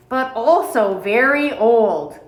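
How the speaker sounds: ordinary delivery, nothing marked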